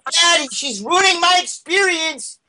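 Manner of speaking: put-on high whiny kid complaining; whiny child. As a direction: whine like a bratty kid. Kid whining to dad.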